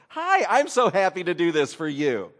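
loud, put-on friendly greeting; fake cheerfulness. Greeting the person they must help, falsely cheerful. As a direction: cover the grumbling with overdone delight.

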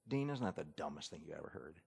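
low, hushed complaint; grumbling to coworker. Acted. Employee to a coworker about the boss, grumbling low. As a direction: grumble it quietly on the side. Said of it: "begins to work up a little bit".

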